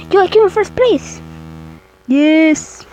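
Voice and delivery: high, loud triumphant yell; excited shouting. Real, not acted. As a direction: cheer the win out loud. Wins the match.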